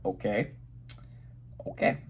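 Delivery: under his breath as the cop follows; nervous quiet okay. Retold or imitated. Retelling the cop tailing him, a hushed uneasy okay.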